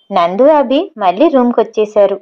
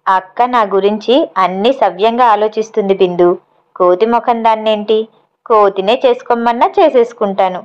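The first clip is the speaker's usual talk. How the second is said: ordinary delivery, nothing marked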